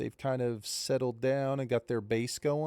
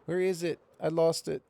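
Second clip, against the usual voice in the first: higher, strained frustrated search; straining with effort; 5 semitones higher, 3 dB louder than usual. Real, not acted. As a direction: push through the frustration. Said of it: "I'm struggling right now".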